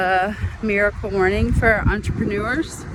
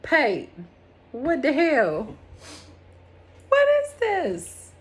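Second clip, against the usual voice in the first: exasperated what the hell; 6 semitones higher, slower than usual. Retold or imitated. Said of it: "One of your favorite things that I say".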